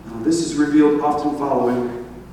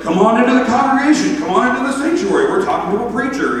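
fast, high imitation of a welcoming shout; boisterous invitation; 7 semitones higher, 3 dB louder, faster than usual. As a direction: wave everyone in, loudly and eagerly. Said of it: "that's how he invited people".

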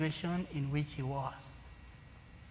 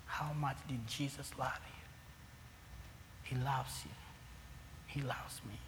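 tender; 10 dB quieter, slower than usual. Real, not acted. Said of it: "soft reflective close".

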